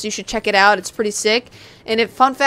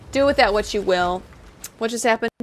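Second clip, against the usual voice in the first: ordinary talk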